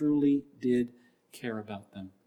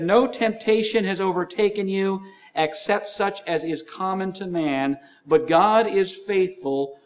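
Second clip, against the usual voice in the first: loud, high, slowed preaching of the verse; preacher reading Corinthians with full force; preached scripture; 5 semitones higher, 5 dB louder than usual. Acted. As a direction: proclaim the verse to the congregation.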